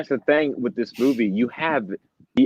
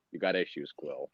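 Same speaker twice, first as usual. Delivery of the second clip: low, much softer deadpan line; nonchalant; 9 semitones lower, 14 dB quieter than usual. Retold or imitated. Movie character telling Quill off, flat and offhand. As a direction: toss it off with total indifference. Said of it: "he was like".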